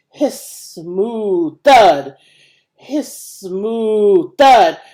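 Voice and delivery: very slow, loud hiss then heavy thud; eerie building sound, drawn out and loud; machine hiss and thud. A narrator's reading. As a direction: make the slaughterhouse machinery cough. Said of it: "the building coughed".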